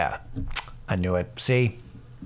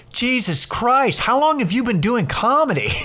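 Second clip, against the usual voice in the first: mock astonishment; 11 semitones higher than usual. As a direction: imitate a stunned young comic. Young comic astonished at veteran's age.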